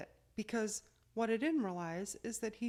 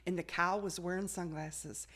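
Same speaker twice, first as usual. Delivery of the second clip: usual speaking voice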